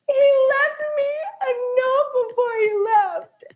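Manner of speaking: high, loud mock sobbing; imitating crying. Friend mimics her happy crying over the note, mock sob. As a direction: wail through happy tears. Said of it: "And she's like".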